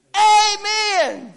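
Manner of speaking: high, loud cry of agreement; hammed-up hearty amen; shouted amen. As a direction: belt it out for every parent in church. Voices all the parents.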